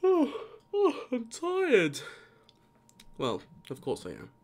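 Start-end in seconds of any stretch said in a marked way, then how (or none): 0.0-2.0 much higher, put-on weary moan; mock tired whine.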